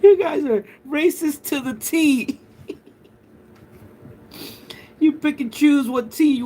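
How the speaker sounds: put-on upper-class British accent; posh British accent. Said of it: "super posh".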